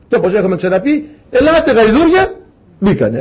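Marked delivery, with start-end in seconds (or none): 1.3-2.3 high-pitched summons, rising at the end; calling out. Acted. Noah summoning animals into the Ark, calling out. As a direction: call the animals over in a high voice.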